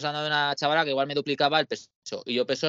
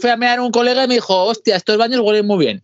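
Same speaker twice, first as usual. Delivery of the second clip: excited; 7 semitones higher, 8 dB louder than usual. Retold or imitated. Excited retelling of bar.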